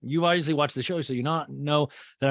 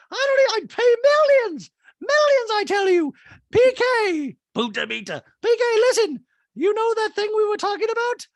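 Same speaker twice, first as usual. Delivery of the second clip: Dorit voice; 8 dB louder than usual. Retold or imitated. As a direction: gush it in a high, loud mock-posh voice. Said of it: "she's now like".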